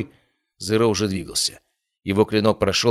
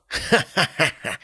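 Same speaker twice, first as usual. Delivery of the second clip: slow, high, unhinged cackle; hysterical laugh; 7 semitones higher, slower than usual. A narrator's reading. Zero behind the hero's back, hysterical high cackle. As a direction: laugh like he just won everything. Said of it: "истерический смех Зеро".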